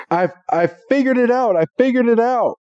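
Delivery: high-pitched excited announcement; excited discovery. Acted. Brock runs out with his discovery.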